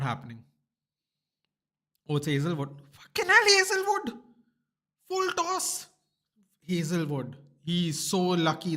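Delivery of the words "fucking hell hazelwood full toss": excited yell. Real, not acted. Fan yells at Hazelwood's delivery, high excited shout. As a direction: scream it at the bowler.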